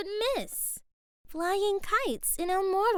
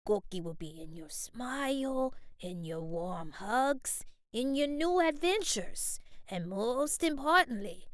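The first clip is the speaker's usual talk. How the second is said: gentle grandfather voice, lower and softer; tender, slow, softer; 8 semitones lower, 3 dB quieter than usual. A narrator's reading. Grandfather comforting his grandson before he leaves, tender and slow. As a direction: soothe him slowly and softly. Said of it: "Abuelo said".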